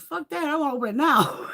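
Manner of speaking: loud, high imitation of impatience; impatient retort. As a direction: mimic an eager friend refusing to wait. Quotes Gina's impatient refusal.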